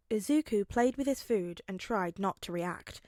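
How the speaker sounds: ordinary delivery, nothing marked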